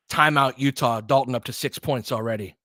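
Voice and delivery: running game call, steady and brisk; live play-by-play. Acted. Streaming the Lakers game to his viewers, live play-by-play. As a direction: call each possession as it happens.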